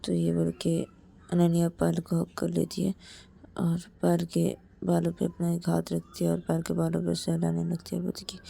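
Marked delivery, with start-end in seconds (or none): none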